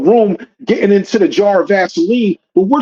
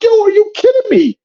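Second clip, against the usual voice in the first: retold high-pitched disbelief; incredulous outburst. Reacting to the parent's casual pickup, incredulous outburst. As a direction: throw it out in stunned protest, pitched way up.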